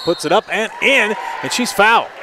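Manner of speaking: high, loud excited call. Real, not acted. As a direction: shout the and-one call. And-one basket called.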